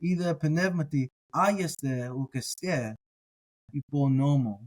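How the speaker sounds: slow reading so aieste and uk este echo; slow down and let aieste and uk este chime; echoing rhythm. Shown as a demonstration. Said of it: "they kinda echo each other".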